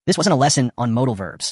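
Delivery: usual speaking voice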